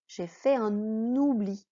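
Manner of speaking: ordinary delivery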